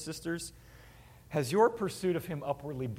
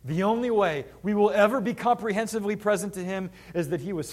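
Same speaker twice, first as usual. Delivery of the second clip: loud, driving sermon emphasis; forceful preaching; 5 semitones higher, 8 dB louder than usual. Acted.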